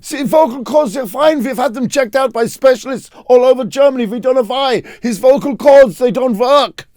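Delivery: high, loud mock German father; worried German parent. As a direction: ham up a baffled German dad. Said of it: "He goes".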